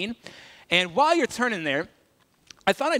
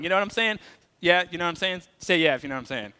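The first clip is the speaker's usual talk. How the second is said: ordinary talk, nothing marked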